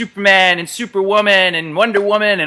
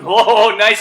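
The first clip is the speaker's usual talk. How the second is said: ordinary talk, nothing marked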